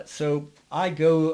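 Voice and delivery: ordinary talk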